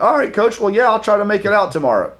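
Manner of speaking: high, fast fake agreement; hammed-up eager yes to the coach; mock eager. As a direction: play along brightly while bluffing.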